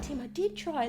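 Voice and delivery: soft, hurt and near tears; a hint of tears, imitating Katie; tearful Katie. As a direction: plead quietly, close to crying. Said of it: "she's tearing up".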